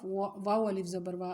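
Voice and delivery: ordinary talk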